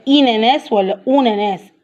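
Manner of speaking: "إناناس" with i, "أوناناس" with u; wrong vowels demonstrated. Shown as a demonstration. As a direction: swap the first a of pineapple for i, then u, in "إناناس ولا أوناناس". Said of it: "ما قلناش بقى".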